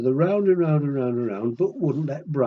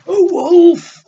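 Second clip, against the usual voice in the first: loud, high and slow, building suspense; dramatic reveal; 14 dB louder than usual. A narrator's reading. Storyteller to children, revealing the wolf with a big swell.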